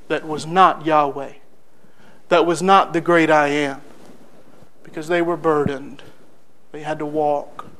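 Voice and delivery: low, softened sermon voice; solemn preaching. Real, not acted. Preacher recounting the wilderness grumbling to the congregation, solemn. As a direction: bring it down low, with weighty pauses.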